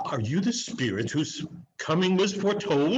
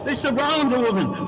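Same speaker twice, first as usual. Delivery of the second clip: fast, high, louder alarm; 6 semitones higher, 5 dB louder, faster than usual. Acted. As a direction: blurt it out in alarm. Scrooge in alarm at the phantoms.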